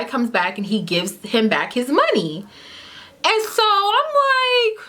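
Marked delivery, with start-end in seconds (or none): 3.2-4.8 whiny disbelief. Retold or imitated. Disbelief at the refund.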